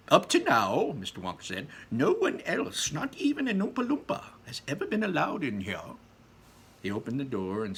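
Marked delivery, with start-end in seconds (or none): 0.1-0.9 Wonka voice. Acted. Wonka voiced in read-aloud. 1.9-6.0 Wonka voice. Acted. Wonka voiced in read-aloud.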